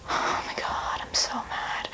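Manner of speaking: breathy whisper, retelling her anger; mock-angry whisper; whispered frustration. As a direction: whisper it like lying in bed fuming. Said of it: "and I was like".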